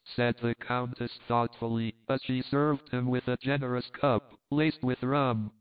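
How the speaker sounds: ordinary delivery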